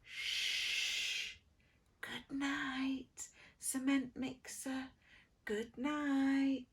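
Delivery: soft whispered good night; whispering. A narrator's reading. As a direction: hush it gently for sleep. Hushed bedtime refrain.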